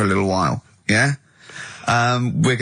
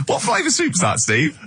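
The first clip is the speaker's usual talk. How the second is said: high squeaky teasing; 8 semitones higher than usual. Pretend.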